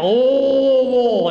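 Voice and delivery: "Louisville" slow and throaty, as LUH-vul. Shown as a demonstration. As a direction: drag "Louisville" out through a blocked throat. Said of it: "sounds like you've got an object lodged in your throat".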